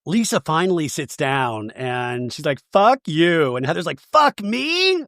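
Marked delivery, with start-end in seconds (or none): 4.0-5.0 very high incredulous question; mock indignant echo. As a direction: throw it back in hammed-up disbelief.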